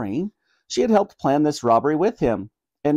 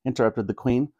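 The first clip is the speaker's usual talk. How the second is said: plain reading voice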